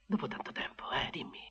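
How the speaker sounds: soft whisper to the woman he lured inside, urging her to talk. Acted. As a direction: lean in close and whisper the question. Intimate whispered question.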